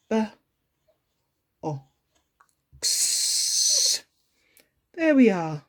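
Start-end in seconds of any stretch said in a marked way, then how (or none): 0.1-4.0 B, o, x as separate phonics sounds with pauses between; slow letter sounds B, o, x for young children; sounding out. Shown as a demonstration. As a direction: say each sound of box alone, holding the hissed end of x. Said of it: "correctly sounding out each sound".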